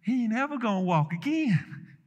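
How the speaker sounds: a hint of a doctor ruling out hope; doctor's verdict. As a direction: deliver the bad news as a lightly mimicked doctor. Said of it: "The doc said".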